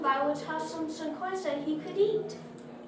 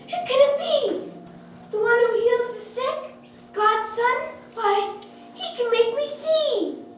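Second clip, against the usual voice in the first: high, loud astonishment; crying out; 10 semitones higher, 7 dB louder than usual. A narrator's reading. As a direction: exclaim with excited, rising hope. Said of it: "he cried".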